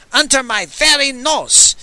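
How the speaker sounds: yelling. Acted. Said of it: "he yelled".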